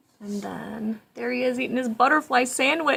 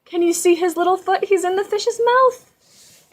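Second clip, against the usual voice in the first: mock gasp; 10 semitones higher, 11 dB louder than usual. Showing the child the picture, mock-alarmed high voice. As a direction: gasp as if it's really scary.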